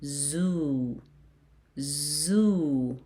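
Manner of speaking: "zoo" with a long held oo; "zoo" stretched into one long syllable. Shown as a demonstration. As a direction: model "zoo" slowly for pupils. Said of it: "So it's gonna pronounce as".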